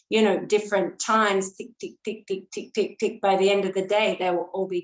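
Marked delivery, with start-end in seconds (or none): 1.6-3.2 rapid run of light ticks; clocks ticking unevenly. Retold or imitated. Teacher illustrating a room of grandfather clocks, quick scattered ticking. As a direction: rattle off ticks like many clocks out of step. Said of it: "grandfather clocks ticking away at".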